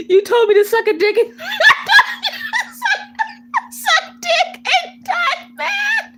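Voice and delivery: high, squealing laugh through the words; helpless laughter. Real, not acted. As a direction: barely get the words out for laughing. Cracks up retelling the insult.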